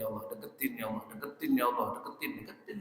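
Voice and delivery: very soft, low pretend pleading; hushed imitation of someone praying under the breath; mock murmured prayer. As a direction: murmur a repeated wish as if praying privately. Mimics a diner's pleading prayer.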